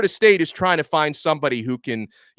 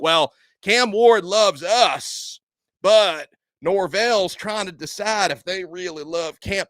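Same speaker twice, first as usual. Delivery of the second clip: slow, higher, smug mock insider voice; mock FSU insider; 6 semitones higher, slower than usual. Host mimicking Florida State writers spinning Cam Ward's visit, slow smug mock voice. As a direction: drawl it out like a self-assured insider.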